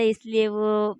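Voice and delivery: ordinary delivery, nothing marked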